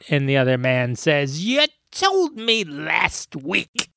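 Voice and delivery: put-on telling an old-men joke in a high, screeching comedian voice; Gilbert Gottfried voice. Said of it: "I'm gonna do the joke in my best Gilbert Gottfried voice".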